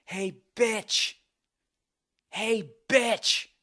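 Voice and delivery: high-pitched mock yell; imitated woman yelling. Woman calling him out in a bar, mock yell. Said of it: "this, this woman yelling".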